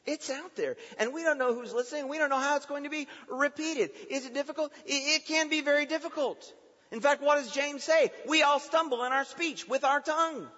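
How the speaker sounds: high, loud pulpit delivery; impassioned preaching. Real, not acted. Preacher warning that words are out there, impassioned and high.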